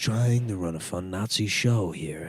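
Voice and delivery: hushed movie-villain actor impression; Alec Baldwin. Comic recasting the Green Room villain, hushed impression. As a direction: play a menacing gang boss in a husky half-whisper. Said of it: "is, is Alec Baldwin".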